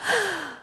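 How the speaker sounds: high startled gasp; sharp gasp. Acted. Acting a feeling surfacing, sudden high gasp. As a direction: catch your breath in shock.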